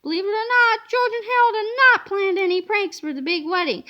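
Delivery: ordinary delivery, nothing marked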